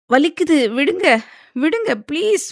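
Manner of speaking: high, pained cry to let go; begging him through the pain of her hair being pulled; tearful pleading. A narrator's reading. Wife begs husband to let go of her hair, crying out in pain. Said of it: "கண்கள் கலங்க".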